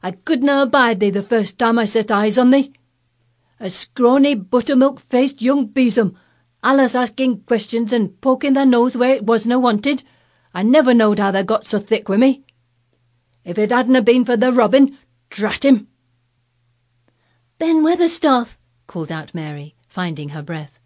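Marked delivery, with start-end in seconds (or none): 0.0-15.9 very loud, higher furious rant; haranguing old Yorkshireman. Acted. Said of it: "he harangued". 17.6-18.6 high, loud breathless call; calling up, gasping. Acted. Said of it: "called up to him with a sort of gasp".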